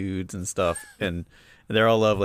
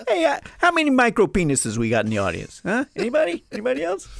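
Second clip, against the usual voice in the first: high, loud crowd-work comic voice; a brash stand-up needling the audience; mock hack comic; 7 dB louder than usual. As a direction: ham up a cheesy club comic working the crowd. Mock Boston stand-up bit.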